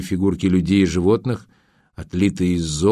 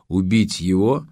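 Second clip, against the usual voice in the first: ordinary delivery, nothing marked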